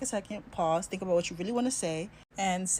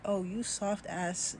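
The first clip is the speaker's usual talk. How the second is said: slow, softer, a hint of a scornful father; unimpressed Jamaican dad; 5 dB quieter, much slower than usual. Dad mocking the soft teacher, slow and scornful. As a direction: drag it out, lightly voiced and unimpressed. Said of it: "He looks at me like".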